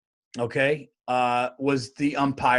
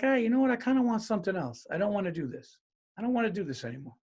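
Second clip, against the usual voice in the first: soft, tentative plea; 5 semitones higher, 6 dB quieter, faster than usual. Retold or imitated. Leaving an abusive relationship.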